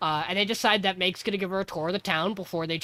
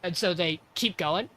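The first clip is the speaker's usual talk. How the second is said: normal talking voice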